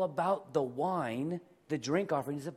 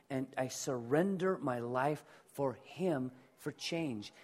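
normal talking voice

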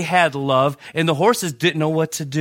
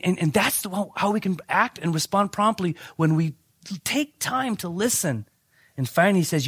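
normal talking voice

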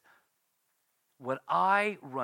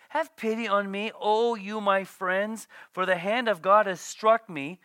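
louder, higher, pleading; anguished plea, raised voice; pleading cry; 4 semitones higher, 6 dB louder than usual. Acted. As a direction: cry out to your friends for pity. Job crying out.

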